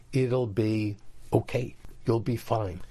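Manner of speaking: usual speaking voice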